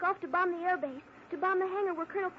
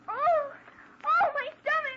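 loud, high hammed-up groans of pain; fake stomachache moaning; 8 semitones higher, 6 dB louder, slower than usual. Jane fakes a stomachache to lure the guard, hammed-up moaning. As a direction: lay the fake agony on thick. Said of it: "Lay it on thick now".

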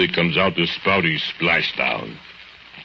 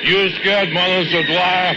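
higher mocking taunt; 14 semitones higher than usual. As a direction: tease him in a raised voice. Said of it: "You hear yourself say".

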